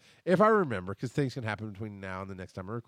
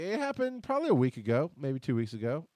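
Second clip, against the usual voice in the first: usual speaking voice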